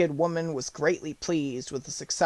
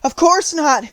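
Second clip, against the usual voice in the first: put-on high, loud girl voice; distressed little girl; little girl's reply; 12 semitones higher, 9 dB louder than usual. A narrator's reading. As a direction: answer as an alarmed child, voice raised. Dorothy answers the melting witch.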